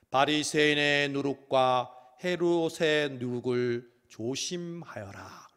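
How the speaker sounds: slow, solemn warning. Acted. Jesus suddenly warning disciples, slow solemn voice. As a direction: intone it gravely like Jesus. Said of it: "갑자기 뜬금없이".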